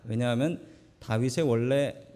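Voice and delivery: ordinary delivery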